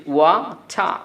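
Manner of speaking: British "ওয়াটা" with the final r dropped; British accent. Shown as a demonstration. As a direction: say "ওয়াটা" slowly, ending on an open ah. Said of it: "British অ্যাকসেন্টে এটাকে আপনি যদি বলেন".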